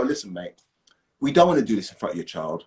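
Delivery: low-key officer's appeal, man to man; officer bartering. Acted. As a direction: imitate a policeman talking someone down. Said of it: "in the position to barter and go".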